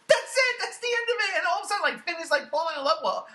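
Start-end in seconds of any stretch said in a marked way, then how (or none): 0.1-1.4 high, loud disbelief at a tiny role; incredulous rant peak; exasperated outburst. Real, not acted. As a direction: throw up your hands, that's all?. Mocking the actress's short role.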